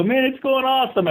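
high-pitched, pleased reply; retold upbeat reply. Agent answering his neighbor's how's it going, upbeat.

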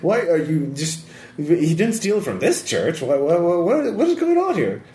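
flustered priest; confused priest. Acted.

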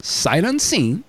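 slow, drawn-out disbelief; slow incredulous restating. Retold or imitated. Shop owner repeating caller's absurd offer, slowly incredulous. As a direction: spell the deal out slowly, word by word.

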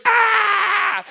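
high piercing shocked scream; a monk's sudden anguished cry; loud scream. Acted. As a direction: shriek out in horror. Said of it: "there was a loud scream".

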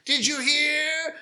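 a neighbour woman bursting to share scandal; gossiping lady. Acted. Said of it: "two ladies gossiping in front of the house".

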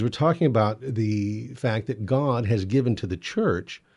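ordinary talk

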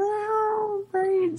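very high, drawn-out zombie moan. Pretend. Zombie cat joke, moaning brains.

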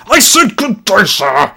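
high, shouted Victorian outrage; Victorian gentleman. Acted. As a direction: bellow a final dismissal as a Victorian gentleman. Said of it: "best read in a Victorian gentleman's accent".

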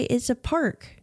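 ordinary talk, nothing marked

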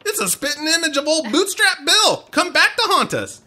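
high pirate voice slipping Southern; squeaky pirate gone accidentally Southern; Rigetti. Acted. Host botching the movie impression, high Southern pirate. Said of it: "I got a Southern thing now".

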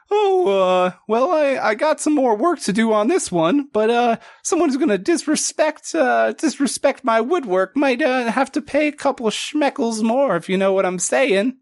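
high squeaky voice, sly and bargaining; haggling otter shipwright. Acted. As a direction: squeak out a sly price hike.

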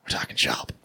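playful secret whisper. Real, not acted. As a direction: whisper it like insider gossip. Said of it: "Why are we whispering?".

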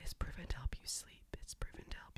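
low soft ASMR whisper. Real, not acted. Whispering ASMR facts to the host. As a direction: whisper it soothingly. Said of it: "A S M R".

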